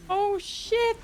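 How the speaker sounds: startled exclamation. Real, not acted. Enemies charging at player.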